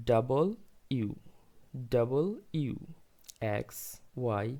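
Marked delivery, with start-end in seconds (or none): 0.1-2.7 ডাবল ইউ said slowly as "double you", in two separate words; ডাবল stressed, then ইউ as "you"; ডাবল ইউ spelt out clearly, the "double" fully sounded; correct letter W. Shown as a demonstration. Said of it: "ডাব্লিউ এর সঠিক উচ্চারণ হচ্ছে".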